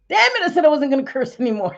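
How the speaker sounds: fast, high and loud, catching herself; excited. Real, not acted. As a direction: rattle it off, worked up and laughing at herself. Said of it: "Sorry, I got excited".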